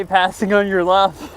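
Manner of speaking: slow mock call-out; imitating a passing call. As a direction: slowly call out the warning in jest. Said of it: "Fish just heard you randomly say".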